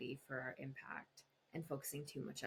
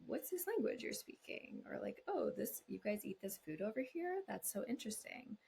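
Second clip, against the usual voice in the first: high, bright with curiosity; curious; 6 semitones higher than usual. Retold or imitated. Partner curious about the other country, high and interested. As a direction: ask like a fascinated traveller. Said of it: "come over with curiosity and say".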